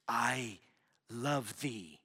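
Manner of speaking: low, hushed solemn Jesus, slow and reverent; King James Jesus voice. Retold or imitated. Pastor imitating the actor Jesus speaking to youth group, low hushed solemn voice. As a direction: intone it like a solemn biblical Jesus. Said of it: "Like he was speaking King James".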